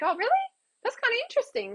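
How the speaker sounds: very high, feigned fascination; playing along. Toying with a scam caller, feigned interest. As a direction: pretend to be intrigued. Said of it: "I wanna say, playing with them".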